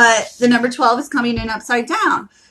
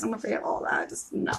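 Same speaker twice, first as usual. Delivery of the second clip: whispered shark fear; 13 dB quieter, faster than usual. Real, not acted. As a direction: breathe it, barely voiced. Whispered fearful aside.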